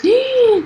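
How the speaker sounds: mock gasp. Imagined parent's first reaction.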